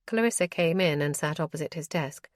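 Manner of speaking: plain reading voice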